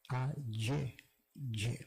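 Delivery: "A J J" drawn out slowly with a throaty J; Yoruba pronunciation. Shown as a demonstration. Teacher demonstrating "A J J" to the class, slow. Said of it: "a maneira que eu sei fazer".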